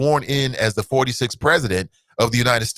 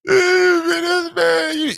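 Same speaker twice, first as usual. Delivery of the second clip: mock; much slower than usual. Real, not acted. High mocking.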